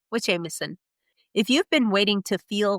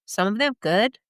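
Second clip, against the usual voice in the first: ordinary talk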